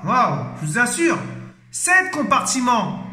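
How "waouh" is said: normal talking voice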